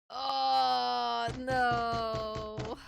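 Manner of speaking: loud high cry of dismay; anguished cry. Real, not acted. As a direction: cry out in despair. Crying out at outcome.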